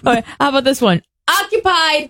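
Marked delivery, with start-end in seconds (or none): 1.3-2.1 high-pitched call of one word; mock yell. Occupant warning off a knocker, mock yell. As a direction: sing it out through the stall door. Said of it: "Who yells".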